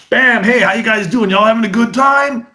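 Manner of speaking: high-pitched, punchy crowd warm-up; energetic American showman's greeting; brash American performer. Acted. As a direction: hype the room like an American magician. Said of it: "the American approach is".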